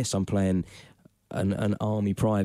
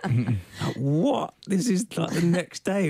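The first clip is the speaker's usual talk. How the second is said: incredulous; 9 semitones higher, much slower than usual. Retold or imitated. Retells own disbelief.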